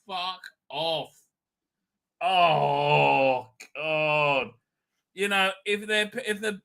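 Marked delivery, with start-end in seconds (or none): none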